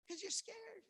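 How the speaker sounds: high-pitched frightened squeak; mock scared. Voices the rider's fear.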